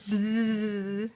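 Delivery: long, sustained pretend buzz; bee buzz imitation. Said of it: "What does a bee say?".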